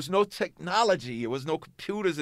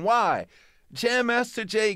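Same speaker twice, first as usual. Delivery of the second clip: normal talking voice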